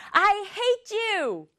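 high-pitched, stretched-out angry cry; mock angry yell. Teacher voices the sister yelling at her brother, mock angry yell. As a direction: shriek it like a furious little sister. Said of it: "이렇게 소리 지르는 게".

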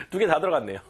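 ordinary delivery

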